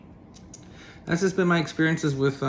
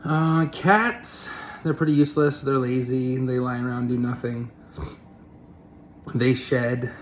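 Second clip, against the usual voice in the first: ordinary talk, nothing marked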